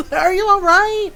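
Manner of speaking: high, loud, fretful apology; worried ranch hand. Retold or imitated. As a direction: rush to check on him, alarmed. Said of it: "ranch hands comes around and was like".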